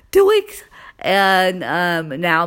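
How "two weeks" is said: two weeks pitched even higher; exasperated high repeat. Real, not acted. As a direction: hammer two weeks again.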